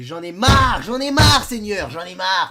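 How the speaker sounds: loud, hammed-up fed-up wail; mock wail. Sick youtuber complaining about his cold, loud hammed-up wail. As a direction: bellow your despair like a drama queen.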